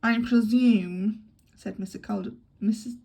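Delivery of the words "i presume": very slow, loud stately matron; lightly voiced imperious dowager; deep-voiced matron. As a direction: Mrs Caldecott, slow and commanding. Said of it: "in her deep voice".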